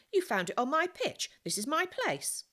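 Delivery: put-on a defiant street boy guarding his patch; boy claiming his turf. A narrator's reading. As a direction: stake your claim like a scrappy street kid.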